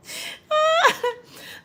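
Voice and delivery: shrieking laugh, very high and loud. Real, not acted. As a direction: burst out in a squealing laugh. Laughing at a viewer comment.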